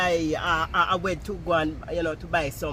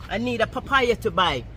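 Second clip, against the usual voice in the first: louder insisting; 6 semitones higher, 10 dB louder than usual. Retold or imitated. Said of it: "I said".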